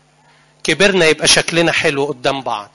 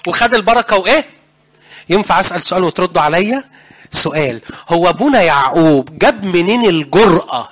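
normal talking voice

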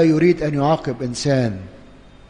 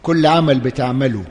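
ordinary delivery

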